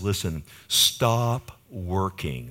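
slow, gentle plea as Jesus; lightly voiced tender Jesus, slowed down. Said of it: "he says this tenderly".

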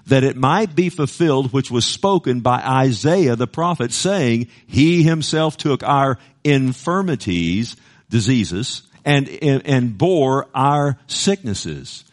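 slow, emphatic reading of the verse; solemn scripture reading. Acted. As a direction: lean on each word of scripture. Reads scripture aloud.

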